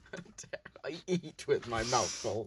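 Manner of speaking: quiet mumbled repeat; muttered repeat. Real, not acted. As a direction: mutter it to yourself. Quiet repeat of same line.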